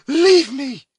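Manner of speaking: high, loud, solemn order; solemn command. A narrator's reading. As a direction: send him away with grave dignity. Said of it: "with a solemnity that for a moment checked".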